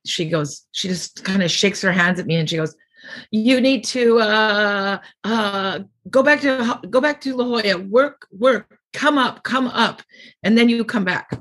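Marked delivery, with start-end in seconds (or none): 3.3-11.3 higher, mock-stern old boss laying down orders; a light imitation of a no-nonsense club owner; imitating Mitzi. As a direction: play the club owner sending her back to practice. Mitzi sends her back to work.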